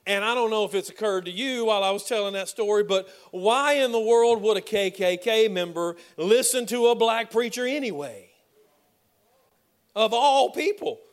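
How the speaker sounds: loud, rising preacher's cry; hammering the point home, voice lifted high; preaching at full force. Acted. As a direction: preach it out to the back row. Preacher builds to climax.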